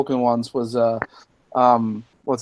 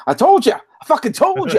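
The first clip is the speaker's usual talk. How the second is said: much higher gloating yell, mock Southern; gloating Johnny Fox. Imagining Johnny Fox on Twitter, gloating high mock Southern yell. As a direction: crow it like a smug told-you-so. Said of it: "I can't do the Southern accent".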